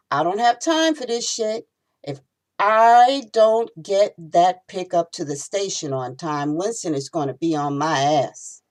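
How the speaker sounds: fed-up snap at the delay; exasperated outburst. Acted. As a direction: vent at the cops blocking him. Frustrated cabbie stuck behind cops.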